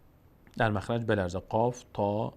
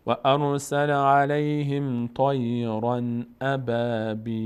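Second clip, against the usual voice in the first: slow chanted verse; Quran recitation; 3 dB louder, much slower than usual. Acted. (تلاوت آهسته)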